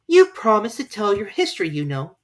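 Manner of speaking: put-on eager young girl; Alice voice.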